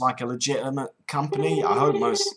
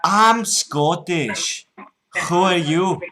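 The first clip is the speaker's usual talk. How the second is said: put-on loud, slow, high Scottish accent; put-on Scots, drawn out and loud; broad Scottish accent; 4 semitones higher, 5 dB louder, slower than usual. Prank caller to scammer, broad Scottish.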